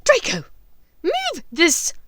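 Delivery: high, loud commanding witch; imperious witch. Acted. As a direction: order the boy about. Bellatrix orders.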